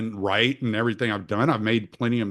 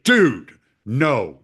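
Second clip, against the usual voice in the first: sharp scold; 6 dB louder than usual. Retold or imitated. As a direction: stop your son cold. Scolding son for hitting sister.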